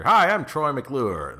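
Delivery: loud, high, drawn-out presenter voice; big, slow showman introduction; Troy McClure. Acted. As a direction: announce yourself like a hammy TV host. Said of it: "what was the other character?".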